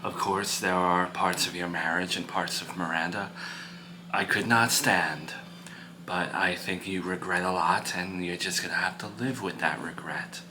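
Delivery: low, hushed counsel to an upset son; calm, frank parent. Acted. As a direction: speak softly and low, measured and serious.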